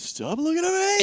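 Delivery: high-pitched mimicked outburst; mock outburst.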